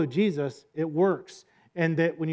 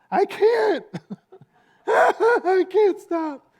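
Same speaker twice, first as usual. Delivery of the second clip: very high, loud whining wail; I can't stop stretched out in a comic whine; whiny believer; 6 dB louder, much slower than usual. Retold or imitated. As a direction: wail like a child who can't help it. Believer voiced, whining, laughing.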